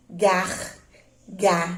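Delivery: gargalhada as gar, ga, lha, da, with gaps; syllable by syllable. Shown as a demonstration. Teacher leading the class to speak together, gargalhada split into syllables. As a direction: chant out gargalhada slowly in separate syllables. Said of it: "Vamos falar junto?".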